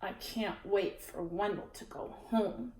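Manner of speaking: soft, low muttered wish; quiet weary to-herself. A narrator's reading. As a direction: mutter it under your breath. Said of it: "she said to herself".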